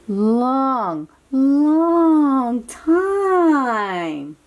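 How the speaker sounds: normal talking voice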